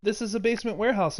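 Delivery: usual speaking voice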